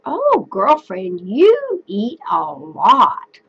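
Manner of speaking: slow, lightly voiced teasing to a friend; drawn out, playful girlfriend tone; friendly chat to a girlfriend. Shown as a demonstration. Teacher translating to the class, slow playful friend voice. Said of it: "I said".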